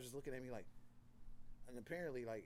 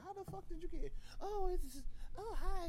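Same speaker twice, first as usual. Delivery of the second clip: high, startled squeak; 5 dB louder than usual. Retold or imitated. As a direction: caught off guard, pitch up. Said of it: "looking at myself like".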